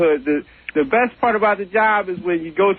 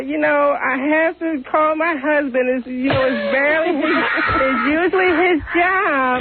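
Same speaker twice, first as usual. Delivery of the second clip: Beulah Schwartz; 8 semitones higher than usual. Retold or imitated.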